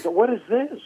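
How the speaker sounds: high, suspicious police officer; suspicious cop. Retold or imitated. Police officer demanding to know what is in the trunk, suspicious. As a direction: question him like a wary cop. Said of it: "He goes".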